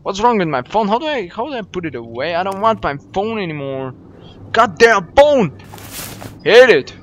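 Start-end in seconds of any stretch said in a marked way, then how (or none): 4.5-6.9 loud exasperated curse; real irritated shout; frustrated outburst. Real, not acted. Frustrated at the game controls.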